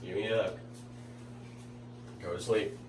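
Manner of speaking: gentle hushed bedtime voice. Real, not acted. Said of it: "Still doing that dad thing".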